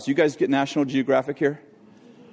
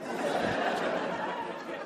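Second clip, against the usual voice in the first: high breathless laugh; 12 dB quieter than usual. Real, not acted. Preacher laughing at story.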